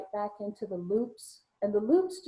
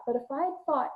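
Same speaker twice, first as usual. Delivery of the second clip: ordinary talk, nothing marked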